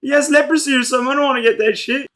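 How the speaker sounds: high, loud mock panicked yell; mock screaming. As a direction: shriek it like they're all freaking out. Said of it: "They all start screaming like".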